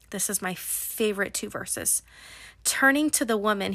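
usual speaking voice

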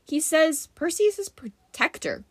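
high-pitched character announcement; announcing. A narrator's reading. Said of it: "Grover announced".

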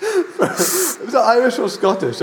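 high, slow words through laughing; helpless laughter. Real, not acted. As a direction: barely get it out for laughing. Cracking up at failed accent.

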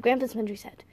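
ordinary delivery

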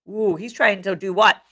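usual speaking voice

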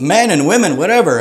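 usual speaking voice

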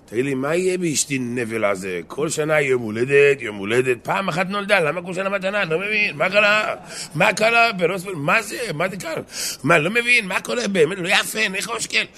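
high-pitched, exasperated husband griping; worked-up complaint. Acted. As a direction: act out an agitated man who just doesn't get it. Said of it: "מה אתה עצבני". (מתלונן בעצבים, בקול גבוה)

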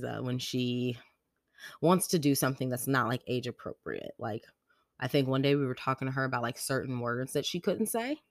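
ordinary delivery

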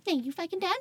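high, whiny echo of the question; mock indignant. Carried friend answers her rescuer back, mock indignant. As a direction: throw it back in a squeaky mock-outraged voice. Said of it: "And I'm like".

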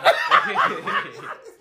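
high laugh. Retold or imitated. Girl echoes then laughs.

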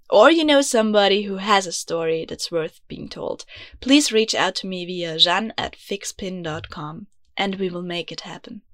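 ordinary talk, nothing marked

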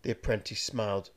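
ordinary delivery, nothing marked

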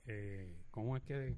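muffled, right on the mic; too close to mic. Real, not acted. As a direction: mouth pressed to the microphone. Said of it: "te vas a tumbar el celular".

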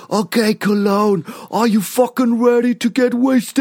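high, slow raspy rock-frontman call. Retold or imitated. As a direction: rasp it out like a hoarse rock singer. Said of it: "der Typ mit so einer richtigen Reibeisenstimme".

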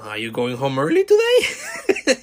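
change the subject way too brightly; awkward subject change. Retold or imitated. Him dodging the awkward moment, forced cheery question.

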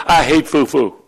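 normal talking voice

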